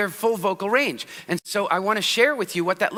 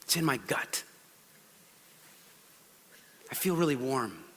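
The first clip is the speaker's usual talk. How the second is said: much softer and lower, understated; soft kinesthetic voice; 7 semitones lower, 10 dB quieter than usual. Retold or imitated. Mimicking a kinesthetic person, soft and low. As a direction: murmur it from the gut. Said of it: "they talk about".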